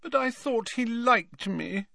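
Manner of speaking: put-on very high, plaintive ghost girl; high, wounded plea. A narrator's reading. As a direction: whine it plaintively, high-pitched. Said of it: "she said plaintively".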